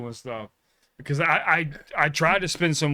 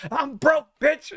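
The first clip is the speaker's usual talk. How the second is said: high, loud mock retort; defiant reply; 11 semitones higher, 3 dB louder, slower than usual. Driver snapping back at the scammer, high mock retort. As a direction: bark back, pitched up. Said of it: "And he was like".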